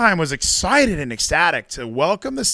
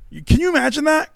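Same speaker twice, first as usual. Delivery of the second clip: incredulous; 12 semitones higher than usual. Real, not acted. Mocking the outraged sisters.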